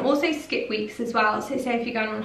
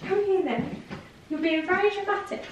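higher, softer coaxing pet voice; pet talk; 8 semitones higher, 5 dB quieter than usual. Real, not acted. Owner calling her dramatic dog over, high soft pet talk. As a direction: coax the dog gently in a raised voice.

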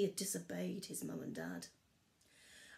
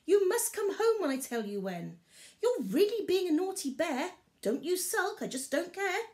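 higher, louder scolding mother voice; scolding mum; 10 semitones higher, 6 dB louder than usual. A narrator's reading. Mother bear scolding Barley, stern and high. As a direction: tell off a naughty cub. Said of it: "his mum called again".

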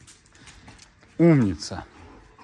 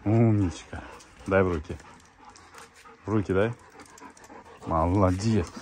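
normal talking voice

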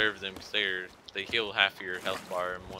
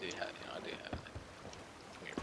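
real hushed whisper; 2 semitones lower, 16 dB quieter, faster than usual. Real, not acted. Player whispering an aside to his friend. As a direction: drop to a whisper, just breath.

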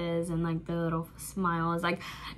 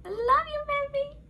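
high gushing squeal. Real, not acted. Smitten fan squealing her love to [name], very high.

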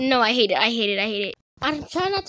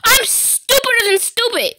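frustrated outburst; 10 semitones higher, 6 dB louder than usual. Real, not acted. Self-mocking outburst.

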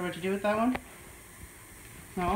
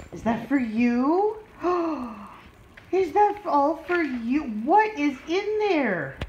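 high, drawn-out questions with a gasp; gushing excitement to a pup; excited pet talk; 5 semitones higher, 3 dB louder than usual. Real, not acted. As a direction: thrill the dog with rising wonder. Hyping the toys to her dog.

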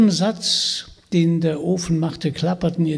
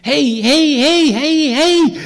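climbing higher and louder with each hey; shrill witch shriek; 10 semitones higher, 7 dB louder than usual. Acted. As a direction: whip the oven on, screaming. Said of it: "mit schrillem Geschrei an". (schrilles, steigendes Geschrei)

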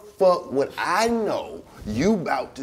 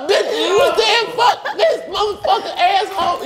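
mock shouted outrage; 14 semitones higher than usual. Friend mimicking her blow-up, laughing high yell. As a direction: scream it like a dramatic girlfriend.

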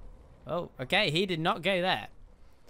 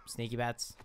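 low, softly spoken stealth voice; sneaky hush; 6 semitones lower, 6 dB quieter than usual. Pretend. Player sneaking up as a bat, hushed. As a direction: keep it down, playfully creeping. Creeping as bat character in game.

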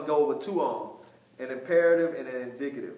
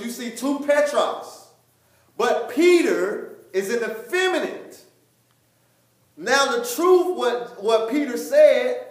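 loud, raised preacher voice; preaching; 7 semitones higher, 7 dB louder than usual. Real, not acted. Teacher explaining Peter and the rock, emphatic preaching. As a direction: drive each point home forcefully.

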